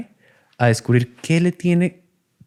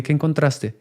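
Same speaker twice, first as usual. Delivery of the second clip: ordinary talk, nothing marked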